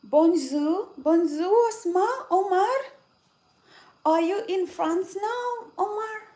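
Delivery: loud, high mock-French greeting; playful French-accented hello to Omar. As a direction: call out bonjour in a hammed-up French accent. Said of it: "Where are we getting this French accent from?".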